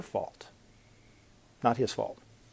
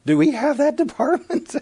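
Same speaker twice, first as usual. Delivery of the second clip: much higher, incredulous question; choked disbelief; 13 semitones higher, 3 dB louder than usual. Retold or imitated. Preacher retells asking a colleague, in high disbelief. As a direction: retell your stunned disbelief, voice jumping up. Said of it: "I choked when I said it".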